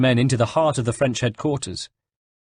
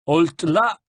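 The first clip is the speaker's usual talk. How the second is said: sharp, high French challenge; barked order; 8 semitones higher, 3 dB louder than usual. A narrator's reading. Sergeant halts strangers at the gate, barked order. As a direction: call out like a sentry ordering a halt. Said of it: "ordered a sergeant commander".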